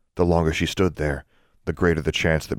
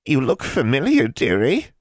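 put-on high, crooning old hag; 9 semitones higher, 5 dB louder than usual. A narrator's reading. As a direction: purr it like a sly witch. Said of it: "The hag said".

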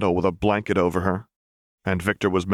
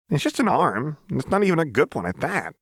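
higher, quick offhand shrug; Jinx voice, dismissive; 9 semitones higher than usual. Acted. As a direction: brush it off.

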